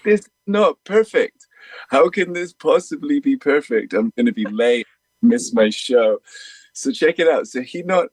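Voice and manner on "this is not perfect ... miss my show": high, frantic mock complaint about being late; panicked protest. Telling a friend about the broken-down RV, panicked and high. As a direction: voice jumps up in retold panic. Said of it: "I'm like".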